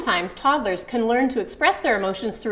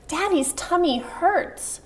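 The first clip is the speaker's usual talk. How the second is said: put-on gentle to toddler; 8 semitones higher, slower than usual. Shown as a demonstration. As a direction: explain it kindly to a small child.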